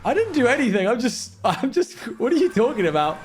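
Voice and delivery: high, hurt mock protest; offended protest. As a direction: act wrongly accused. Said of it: "You sound, like, so offended".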